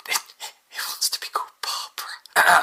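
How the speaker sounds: hushed whisper to a colleague; whispered aside. Acted. Scientist whispering to a colleague about the AI. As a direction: breathe it aside so the AI can't hear.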